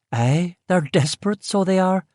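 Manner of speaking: chuckling local lilt; Belfast cook. Acted. Cook agreeing about the trophies, amused, in a Belfast accent.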